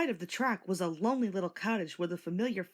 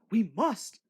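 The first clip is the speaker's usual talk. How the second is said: louder determined promise; brave resolve; 2 semitones higher, 5 dB louder than usual. A narrator's reading. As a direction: set his jaw and insist. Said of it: "he said bravely".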